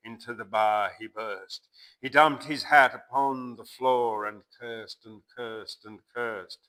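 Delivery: measured, rhythmic poem reading; bush ballad recitation. A narrator's reading. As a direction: recite it like an old campfire yarn.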